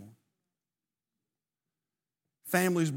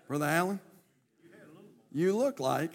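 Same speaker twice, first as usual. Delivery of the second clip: ordinary talk, nothing marked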